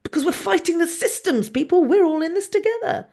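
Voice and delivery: high-pitched mock rallying cry; mocking campaign slogan. Host mocking the association's call to fight systems, mock rallying. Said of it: "and then they go".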